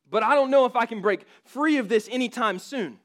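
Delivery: the sinner insisting he is unworthy; pleading sinner. Acted. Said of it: "dialogue between a person".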